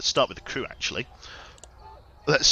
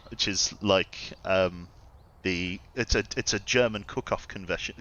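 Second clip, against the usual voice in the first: ordinary talk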